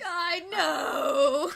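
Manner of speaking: high, loud drawn-out wail; exclaimed wail. Acted. Player agrees they've been hurting people, loud high wail.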